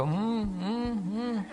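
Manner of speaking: weak breathy hum of a failed roar, high and soft; lion with a dead battery, mock failed roar; feeble roar. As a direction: try to roar and only manage a soft hum. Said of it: "battery down".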